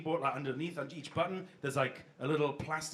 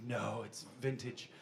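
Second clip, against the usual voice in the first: low, slow, softer put-on dignity; mock defensive excuse; 7 semitones lower, 7 dB quieter, slower than usual. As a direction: insist on it quietly and slowly. Retold excuse for off food.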